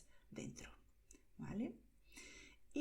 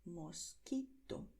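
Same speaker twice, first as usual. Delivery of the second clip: Mosquito said slowly, syllable by syllable; slow model word; 2 semitones lower, 4 dB quieter, much slower than usual. Shown as a demonstration. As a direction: spell it out in sound for learners, Mosquito. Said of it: "la palabra mosquito".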